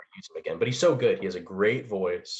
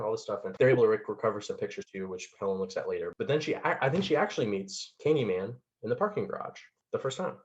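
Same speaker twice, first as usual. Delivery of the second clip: ordinary talk, nothing marked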